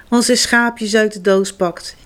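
ordinary delivery, nothing marked

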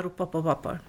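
ordinary delivery, nothing marked

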